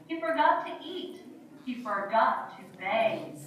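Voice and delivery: ordinary talk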